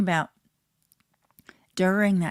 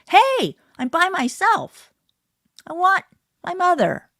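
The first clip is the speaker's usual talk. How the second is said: put-on lonely baby; 11 semitones higher, 10 dB louder than usual. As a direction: wail like a baby left alone.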